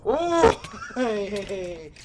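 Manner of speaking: high, loud burst of alarm; sudden panicked hey hey; startled shout. Real, not acted. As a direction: yelp as you get jumped.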